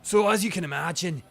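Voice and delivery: wobbly put-on Scottish burr; Scottish accent. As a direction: explain it as a comic Scottish old man. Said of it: "this accent is a bit inconsistent".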